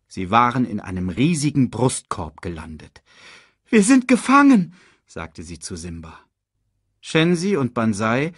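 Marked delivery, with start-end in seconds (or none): 3.7-4.7 high, loud dismay; alarmed cry. A narrator's reading. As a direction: cry out that you are trapped. Cub trapped by hyenas.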